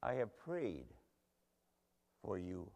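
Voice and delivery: soft, low, tender repeat; hushed assurance. Acted. Jesus reassuring Simon before his fall, hushed and tender. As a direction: let it sink down gently, with a pause.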